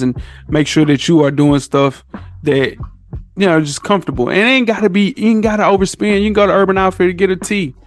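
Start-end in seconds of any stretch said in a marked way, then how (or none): none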